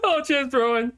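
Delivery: normal talking voice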